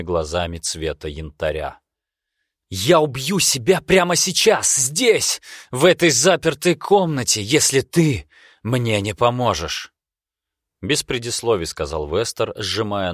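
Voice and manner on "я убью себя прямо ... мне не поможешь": high, loud desperate threat; desperate shout. Acted. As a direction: scream it, knife at his own throat. Vester threatens with knife at throat.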